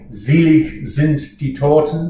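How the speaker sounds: Selig sind die Toten with a Z at the start of selig; sing-speak Selig with a buzzing Z and a live G; German diction demo. Shown as a demonstration. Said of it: "The S sound at the beginning of a word in German is always Z".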